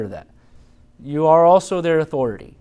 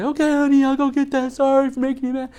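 meek parent; 11 semitones higher than usual. Retold or imitated. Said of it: "and you say".

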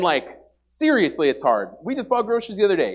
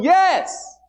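a hint of God's voice, higher and louder; 6 semitones higher, 4 dB louder than usual. God reassures the doubting believer, lightly voiced. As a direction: reassure like God promising care. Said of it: "He's going".

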